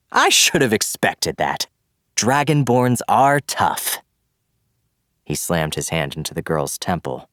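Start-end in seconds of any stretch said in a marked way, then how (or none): 0.1-4.0 high, loud, slow cackling taunt; cackling villain. Acted. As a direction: laugh it out, gloating. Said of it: "and cackled".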